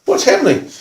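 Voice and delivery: high-pitched puzzled question; utterly baffled, acted; retold disbelief; bewildered. Bewildered thought at sudden violence.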